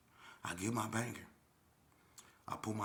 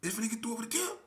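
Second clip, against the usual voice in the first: squeaky voice; 6 dB louder, faster than usual. Retold or imitated. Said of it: "He was like".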